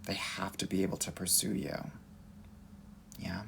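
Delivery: soft whispered emphasis; whispering. Real, not acted. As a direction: whisper it like a secret.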